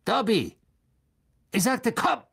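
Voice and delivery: sharp, high-pitched order; impatient command. A narrator's reading. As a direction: summon a disobedient servant. Master repeats ignored order sharply.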